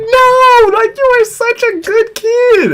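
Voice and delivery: put-on high, gushing old teacher. Teacher gushing over her grown-up former student. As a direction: coo over him like a doting teacher. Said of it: "she was like".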